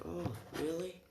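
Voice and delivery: high, soft, questioning surprise; pleasantly surprised. Retold or imitated. Hero hears he was impressive, modestly surprised. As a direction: lift it high and small, as if not believing the praise.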